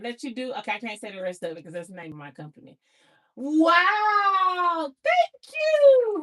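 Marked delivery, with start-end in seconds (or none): 3.4-6.2 loud, high-pitched, drawn-out gratitude; overjoyed thanks. Real, not acted. Worker reacting to glowing praise from the team, overjoyed. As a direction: squeal your thanks at full delight. Thrilled by coworker's written praise.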